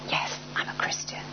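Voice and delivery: hushed, furtive confession; barely audible whispered admission; secretive whisper. Retold or imitated. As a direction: whisper it so nobody overhears. Secret admission after looking round.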